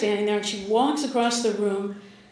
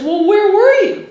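loud, high demanding question; demanding mother; 9 semitones higher, 9 dB louder than usual. Retold or imitated. Mother confronting her daughter, loud and demanding. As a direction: press her for an answer.

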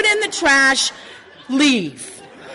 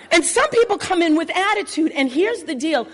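ordinary talk, nothing marked

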